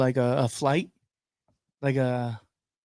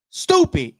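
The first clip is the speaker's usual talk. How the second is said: scoffing; 5 dB louder than usual. Real, not acted. Scoffing at private profiles.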